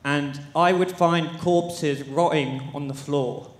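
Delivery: ordinary talk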